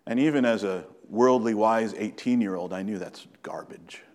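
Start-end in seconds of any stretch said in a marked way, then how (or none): 2.7-4.0 a hushed confiding verdict; whispered aside. Acted. Preacher recalling a street evangelism exchange, whispered aside. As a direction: whisper it under your breath.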